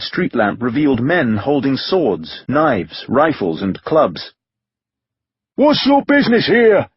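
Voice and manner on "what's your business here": high, loud challenge; demanding. A narrator's reading. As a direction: demand it of the strangers. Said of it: "one of the men demanded".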